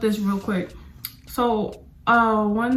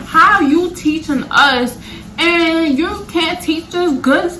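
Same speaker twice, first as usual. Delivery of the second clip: higher, louder and slow, fed up; exasperated; 7 semitones higher, 7 dB louder, slower than usual. Retold or imitated. Student complaining to the terrible teachers, loud and fed up. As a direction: demand answers from bad teachers.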